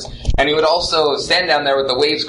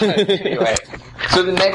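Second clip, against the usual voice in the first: laughing; 7 semitones higher than usual. Real, not acted. Still laughing after the joke.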